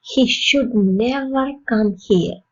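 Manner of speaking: slow, even statement; slow model sentence. Shown as a demonstration. Teacher modelling a sentence for learners, slow and even. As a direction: say it slowly and evenly. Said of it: "model sentence".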